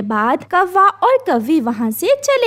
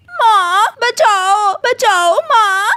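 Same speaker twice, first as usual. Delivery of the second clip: desperate cry for help; 7 semitones higher, 3 dB louder, slower than usual. Acted. Beaten, screams for mother.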